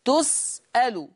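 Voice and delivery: تسأل said slowly as tus'alu; u on ta and final lam in تسأل; Hafs reading. Shown as a demonstration. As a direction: say تسأل with both dammas. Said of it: "يضم التاء واللام".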